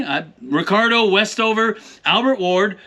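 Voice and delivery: usual speaking voice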